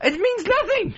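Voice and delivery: real high-pitched indignation; exasperated outburst. Real, not acted. Host rejecting staffer's claim of responsibility, high exasperated outburst. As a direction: pitch leaps up in protest.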